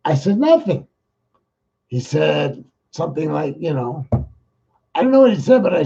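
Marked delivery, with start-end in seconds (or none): 0.4-0.9 innocent retold reply. Retold phone call with friend.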